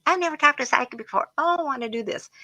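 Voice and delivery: high, fast and bubbly; a giddy young woman meeting her first psychic; giggly excited girl. Retold or imitated. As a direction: gush like a thrilled young girl. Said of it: "They're just giggling".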